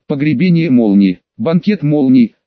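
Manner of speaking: ordinary delivery, nothing marked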